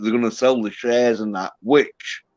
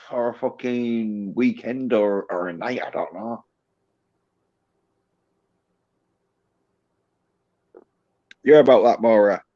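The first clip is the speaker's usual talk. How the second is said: muffled, as if behind a hand. Real, not acted. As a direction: speak through a covered mouth. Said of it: "you're a little muffled".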